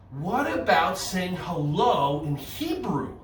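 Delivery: usual speaking voice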